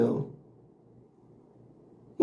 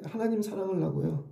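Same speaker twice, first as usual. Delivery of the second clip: soft answer; 10 dB quieter than usual. Pretend. Imagined answer, third time.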